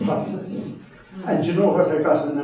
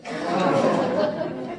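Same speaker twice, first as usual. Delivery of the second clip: laughing. Real, not acted. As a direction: chuckle under the breath. Laughs at bloody American remark.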